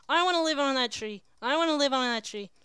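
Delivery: put-on loud, childish-style pleading cry; whiny demand. Player wishing to live on that tree, loud whiny demand. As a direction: cry it out insistently, twice alike.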